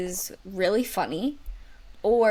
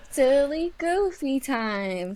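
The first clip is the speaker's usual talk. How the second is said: slow, higher, drawn-out segment title; goofy announcement; 5 semitones higher, much slower than usual. Pretend. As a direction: stretch it out playfully like a jingle. Echoes the segment name as jingle.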